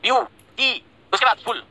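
View, टी and फुल as three isolated beats; pausing between syllables. Shown as a demonstration. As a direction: stop after view, after टी, then give फुल. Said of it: "ये तीन बार रुका हमने".

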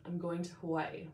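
ordinary talk

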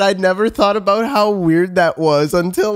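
usual speaking voice

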